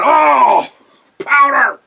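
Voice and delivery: high, loud hammed-up wail; mock horrified cry. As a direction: cry out like it's a disaster. McMahon losing his mind over powder.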